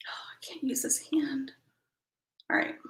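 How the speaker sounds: whispered complaint to herself. Real, not acted. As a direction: breathe it out unvoiced. Muttering to herself.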